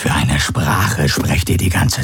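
langsame, tiefe, raunende Wesensstimme; fremdartiges Wesen. Acted. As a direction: jedes Wort schwer und bedächtig setzen. Gaurus spricht weiter. (Gaurus raunt langsam und tief seinen Gefährten zu)

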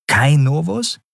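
"Kai Novus" echoed high with a sharp rise at the end; mocking echo. A narrator's reading. Orin echoes the boy's name back, mocking and high. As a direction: repeat his name back with a teasing lift.

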